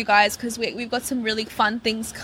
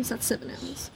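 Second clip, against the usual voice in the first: whispered aside; 12 dB quieter than usual. Real, not acted. Aside while counting.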